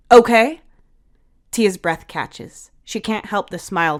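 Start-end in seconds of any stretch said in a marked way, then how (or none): 0.1-0.6 stern warning. Acted. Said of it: "glares at both Tyler and River".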